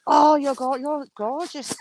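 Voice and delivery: ordinary talk, nothing marked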